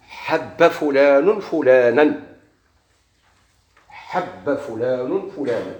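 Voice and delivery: slow, word by word example sentence; slow dictation. Shown as a demonstration. Teacher dictating the example sentence to students, slow and deliberate. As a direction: spell it out for the class to copy.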